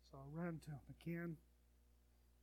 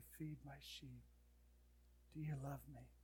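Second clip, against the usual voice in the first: gentle Jesus; 2 semitones lower, 8 dB quieter than usual. Acted. Jesus speaking to Peter.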